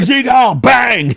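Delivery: high, loud, hammed-up yell; over-the-top action-movie cry; mock battle shout. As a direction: shout it like a cartoon fighter. Repeats the mock fight shout.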